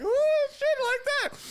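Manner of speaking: very high mock-female squeal; admiring girl impression. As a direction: coo in an exaggerated falsetto like an impressed girl. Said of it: "the girls be like".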